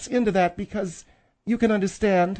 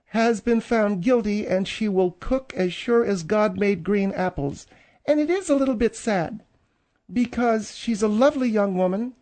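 ordinary delivery

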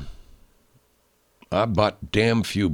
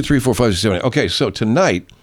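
ordinary talk

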